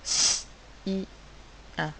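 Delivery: "S" as a long hiss, then "I" and "un" apart; three isolated phonics sounds "S, I, un"; separate sounds. Shown as a demonstration. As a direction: sound out "S, I, un" with pauses. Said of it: "trois sons".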